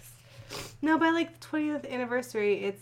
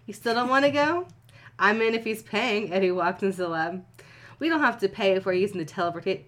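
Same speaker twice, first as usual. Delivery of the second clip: story reading; 5 dB louder than usual. Acted. Reads fanfiction aloud to cohost.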